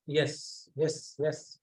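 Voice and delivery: ordinary talk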